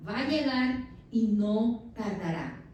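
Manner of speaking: slow, weighty promise; God's promise. Acted. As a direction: lay each word down slowly as God speaking. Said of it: "la respuesta del Señor". (voz lenta y solemne de Dios)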